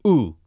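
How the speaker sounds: "Oo" with lips rounded and held; oo vowel. Shown as a demonstration. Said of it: "Keep your lips the same".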